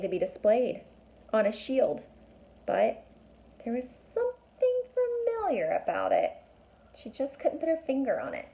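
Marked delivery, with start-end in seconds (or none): 3.6-6.3 mysterious hint. A narrator's reading. Mysterious narration.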